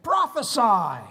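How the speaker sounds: loud, high mocking shout; retold scream. Mockers screaming at the blindfolded prisoner, loud retold yell. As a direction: call it out like a jeering mob. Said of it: "they begin to scream at him".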